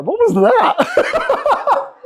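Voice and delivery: high, drawn-out laughing question. Real, not acted. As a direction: ask it while cracking up. Laughing at Jenny's punch.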